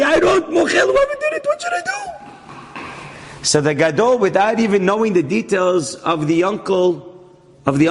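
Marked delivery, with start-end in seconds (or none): 0.0-1.9 high, strained plea; retold panic. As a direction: cry it out as the cornered uncle. Uncle retells his dilemma in panic.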